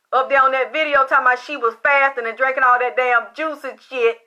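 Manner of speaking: ordinary talk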